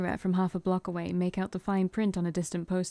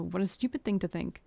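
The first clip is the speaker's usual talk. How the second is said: ordinary talk, nothing marked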